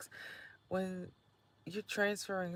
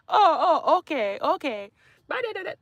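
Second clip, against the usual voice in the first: mock loud goodbye; 12 semitones higher, 13 dB louder than usual. Continues mocking the yeller.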